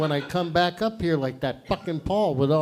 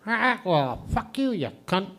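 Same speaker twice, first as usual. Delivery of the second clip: squawk, then curse as a parrot; comic foul-mouthed parrot; 3 semitones higher than usual. Acted. Parrot answering the threat of the fridge, squawky parrot voice. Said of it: "He goes".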